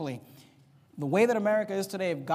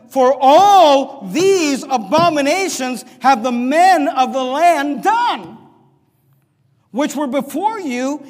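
loud, very slow, declaiming each phrase; preached scripture reading; 3 semitones higher, 7 dB louder, much slower than usual. Real, not acted. Pastor thundering Leviticus at his congregation, preached scripture reading. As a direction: thunder the verse over the church.

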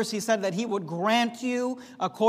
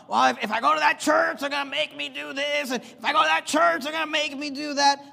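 higher, put-on voice of a reluctant visitor; mock whiny excuse; 5 semitones higher than usual. Preacher mocking a fearful visitor's excuses, higher put-on voice. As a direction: parody a nervous would-be churchgoer.

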